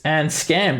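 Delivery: ordinary talk, nothing marked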